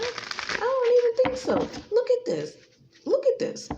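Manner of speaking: mocking high-pitched scoff at Kimberly; scoffing disbelief. Scoffing at the accused.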